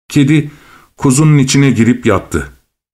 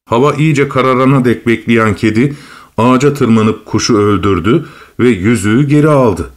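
usual speaking voice

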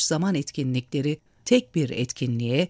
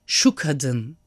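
mild narrated shout; 3 semitones lower than usual. A narrator's reading. Narrator voicing Olive's sudden cry about a woman, mildly shouted. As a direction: read it as a restrained exclamation. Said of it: "diye bağırdı".

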